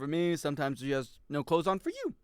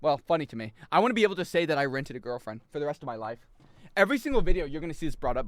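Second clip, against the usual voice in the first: usual speaking voice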